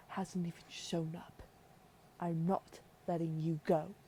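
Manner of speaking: put-on stern queen in a deliberate British accent; emphatic British-accented mother laying down the law. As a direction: forbid it in British royal tones, leaning on key words. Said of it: "I felt like giving her a British accent".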